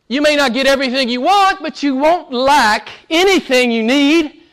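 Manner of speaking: usual speaking voice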